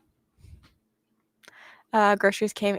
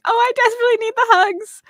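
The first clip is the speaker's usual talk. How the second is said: teary plea; 14 semitones higher, 8 dB louder than usual. Real, not acted. Emotional reply, almost crying.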